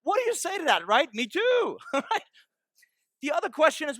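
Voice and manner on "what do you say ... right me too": ordinary talk, nothing marked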